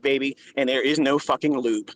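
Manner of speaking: normal talking voice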